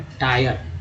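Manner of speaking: टायर with its I said as "eye"; long I sound. Shown as a demonstration. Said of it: "आई का उच्चारण जो है वो आई के रूप में".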